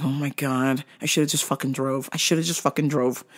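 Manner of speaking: quiet exasperated mutter to herself; muttered aside. Retold or imitated. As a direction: grumble under your breath in despair. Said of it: "I was like".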